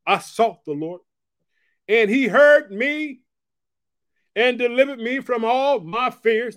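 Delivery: "he heard me" rising loud and slow. Shown as a demonstration. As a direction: hammer the verse home the second time. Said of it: "I gotta say it again".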